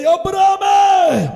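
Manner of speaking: high, slow, loud call of the name; God calling out to Abraham to stop the sacrifice; God's call. Acted. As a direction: cry out the name to stop the sword. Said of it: "ഒരൊറ്റ വിളി".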